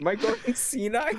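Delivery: high-pitched self-doubt; high in-character bewilderment; bewildered in character. Pretend. Character doubts own senses.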